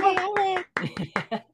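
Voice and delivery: high, drawn-out cheer; excited cheer. Real, not acted. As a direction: cheer the winner in a long high voice. Cheering an announced prize winner.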